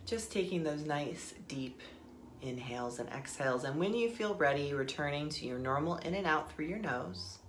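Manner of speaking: soft, unhurried meditation guide voice; guided meditation. Acted. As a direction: ease them inward with calm breath cues.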